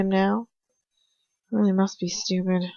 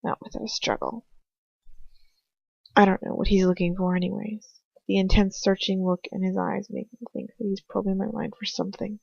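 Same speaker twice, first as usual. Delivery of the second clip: usual speaking voice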